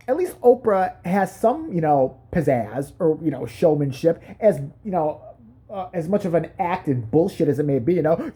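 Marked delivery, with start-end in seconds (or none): none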